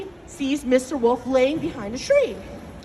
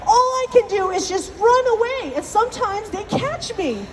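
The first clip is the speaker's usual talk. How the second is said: higher-voiced plaintive little rabbit; complaining rabbit; 3 semitones higher than usual. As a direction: plead your grievance to a mighty god. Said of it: "complain to him".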